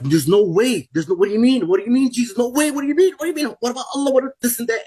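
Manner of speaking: panicked disbelief. Retold or imitated. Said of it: "and I'm like".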